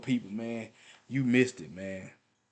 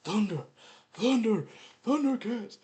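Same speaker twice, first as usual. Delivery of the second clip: cartoon battle cry; 8 semitones higher than usual. Child pretending a stick is the Sword of Omens, rising battle cry. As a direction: chant building steadily higher to a climax. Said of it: "I used to go".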